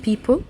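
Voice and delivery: people opened with a puffed p; breathy p. Shown as a demonstration. Teacher contrasting the letters, people with an aspirated p. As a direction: let air burst on the p of people. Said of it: "با اللي فيها همس".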